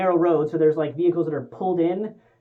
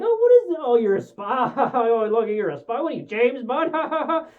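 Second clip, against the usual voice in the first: sarcastic mockery; 3 semitones higher than usual. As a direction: sneer at him like it's a joke, laughing. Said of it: "he's a sarcastic asshole".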